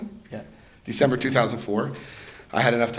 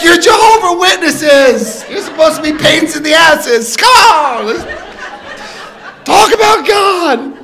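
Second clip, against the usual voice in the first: very high, loud, slow laughing shout; hammed-up eager host; pushy enthusiasm; 12 dB louder than usual. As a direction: insist they stay and preach. Pushing the Witnesses to stay.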